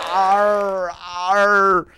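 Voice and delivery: low growling arr; low pirate arr growl. Acted.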